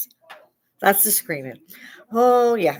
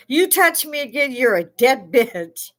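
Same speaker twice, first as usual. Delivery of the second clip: louder, higher threatening warning; threat to nurse; 7 semitones higher, 5 dB louder than usual. Retold or imitated. Warning the nurse not to touch her leg, a loud, high threat. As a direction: snarl a death threat at her. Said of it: "and I said".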